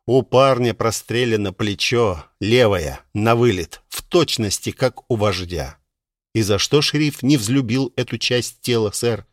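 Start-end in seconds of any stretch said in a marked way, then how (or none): none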